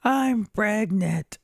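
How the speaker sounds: high, drawn-out mock wail; imitated tearful cry. Wife breaking her news on the phone, mock-tearful. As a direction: stretch it out, imitating a crying wife. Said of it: "And she's crying".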